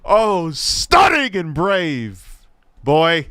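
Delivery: slow, high, drawn-out mock admiration; sarcastic praise. Real, not acted. Streamer reacting to the video with sarcastic praise. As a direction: stretch out the fake awe.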